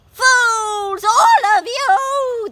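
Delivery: very loud, high, slow witch screech. Acted. The beaten witch screeches at everyone, high and furious. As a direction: shriek it in pure fury. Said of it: "the witch screeched".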